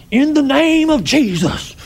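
high, loud, slow hammed-up deliverance cry; over-the-top mock rebuke of a devil; mock shouting exorcist. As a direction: parody a preacher yelling at a demon. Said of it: "and scream at 'em".

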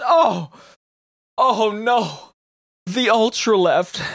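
high, sarcastic gasp of fake alarm; mock horror. Podcaster mocking a Liberal MP's complaint, in fake horror. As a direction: feign shock at the ultra left.

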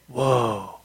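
low, soft, drawn-out whoa; a low, hushed whoa of amazement; mock whoa. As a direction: act out being stunned, quietly. Imagined listener reacts in awe.

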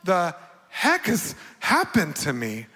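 high, stretched disbelief; bewildered outcry. Retold or imitated. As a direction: cry out in confusion. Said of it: "I'm like".

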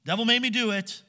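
whiny excuse. Retold or imitated. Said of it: "I'm the victim".

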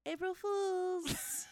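high, drawn-out, slow singsong; sheepish reveal. Retold or imitated. Mom reveals prank to wailing kids, high and sheepish. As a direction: squeeze it out high and slowly.

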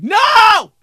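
very high, very loud yell; acted explosive, high-pitched shout; shouted refusal. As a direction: shriek a sudden refusal. Acted refusal of a homeless man.

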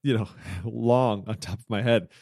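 ordinary delivery

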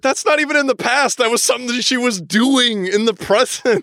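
high-pitched, outraged objection; indignant disbelief. Real, not acted. Reader disputing the character's excuse, indignant. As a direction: protest the excuse as plainly absurd.

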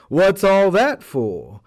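suspicious and loud; alarmed tiger. Acted.

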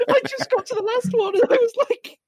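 high, loud laugh-crying. Real, not acted. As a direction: crack up while telling it. Laughing hard at herself.

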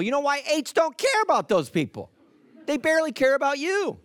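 high-pitched mock rant; blunt scolding. Acting out the blunt friend's rant.

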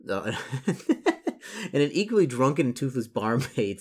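real laugh breaking into the reading. Real, not acted. Reader amused by the drunken toothless barmaid, laughing as he reads.

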